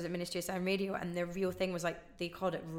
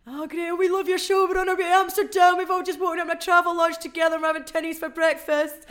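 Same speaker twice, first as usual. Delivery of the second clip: much higher, much louder lads' voice; stag-do caller to the DJ, overexcited; excited stag caller; 10 semitones higher, 11 dB louder than usual. Retold or imitated. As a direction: gush like a hungover lad on a road trip. Stag caller gushing on air.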